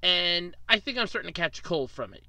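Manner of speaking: ordinary delivery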